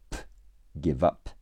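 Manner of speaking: usual speaking voice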